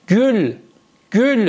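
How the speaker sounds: rounded ü in گل, not Persian o; Turkish gül. Shown as a demonstration. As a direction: model گل as "gül", jaw forward.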